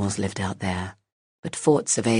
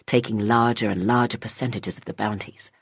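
plain reading voice

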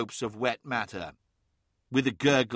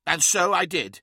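protesting; 5 semitones higher, 3 dB louder than usual. Acted. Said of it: "protested Hathor Mart".

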